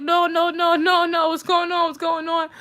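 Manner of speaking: high, insistent string of questions; retold alarmed reply. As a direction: imitate a flustered man fending someone off.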